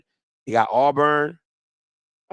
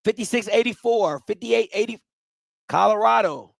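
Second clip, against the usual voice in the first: usual speaking voice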